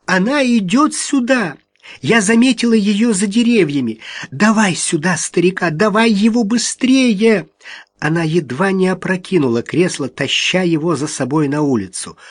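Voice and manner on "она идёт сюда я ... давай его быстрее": panicked. A narrator's reading. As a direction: burst in terrified, urging him on. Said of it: "в ужасе вбежала в сторожку".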